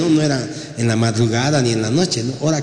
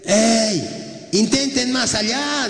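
high-pitched, drawn-out call; calling from afar; 7 semitones higher, much slower than usual. Acted. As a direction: hail fishermen out on the water from the shore. Lord calls from the shore. (llamando de lejos, voz aguda)

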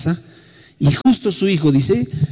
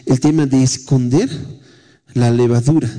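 ordinary talk

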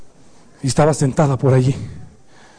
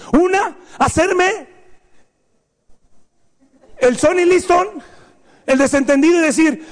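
mock excuses; 11 semitones higher than usual. Mock excuses, high and loud.

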